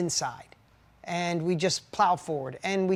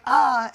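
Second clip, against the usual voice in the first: louder, higher exasperated "Ah"; frustrated groan; 6 semitones higher, 8 dB louder than usual. Retold or imitated. Tired spouse snapping after a bad day, exasperated groan. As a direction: let the day's stress burst out. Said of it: "you're like".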